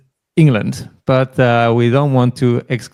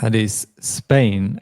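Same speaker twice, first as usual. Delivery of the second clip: ordinary delivery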